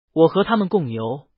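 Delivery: ordinary delivery, nothing marked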